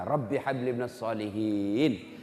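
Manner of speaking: slow, low, soft prayer; gentle prayer. Retold or imitated. Preacher shows a parent praying gently for a child. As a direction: say it tenderly and slowly.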